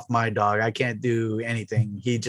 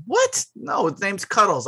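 mock indignant mom; 6 semitones higher than usual. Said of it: "She goes".

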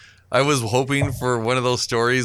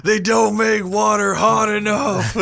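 higher, slow mock Joe; a hint of Joe from Family Guy, drawn out; lightly voiced cartoon cop; 7 semitones higher, 3 dB louder, slower than usual. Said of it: "Be like Joe in that fucking one episode of Family Guy".

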